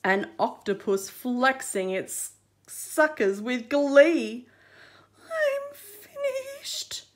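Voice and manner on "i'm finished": very high, slow, crying whimper. Acted. Crab facing the octopus, tearful whimper. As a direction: whimper in hopeless defeat. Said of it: "Fab whimpered".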